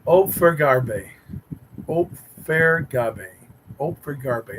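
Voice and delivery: "Opfergabe" spelled out slowly, ohp-fer-gah-beh, each syllable drawn out; careful pronunciation. Shown as a demonstration. Streamer practising a viewer's German name, slow and careful. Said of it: "That sounds pretty".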